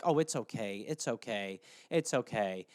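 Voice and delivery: low, soft comforting; soothing. Retold or imitated. Partner consoling the jealous one in the thruple, soft soothing. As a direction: pat them down gently.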